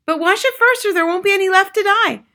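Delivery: high, loud bossy mother. A narrator's reading. Mother warns husband to wash first, high and insistent. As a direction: warn him in a high, insistent voice.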